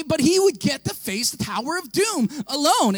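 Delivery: ordinary delivery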